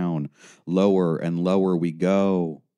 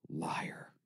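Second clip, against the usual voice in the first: whispering; 14 dB quieter than usual. A narrator's reading. Inner accusing whisper.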